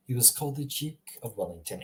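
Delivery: quiet put-on British accent, "Duke" as juke. Shown as a demonstration. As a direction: throw in a soft-spoken British line. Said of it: "the British would say".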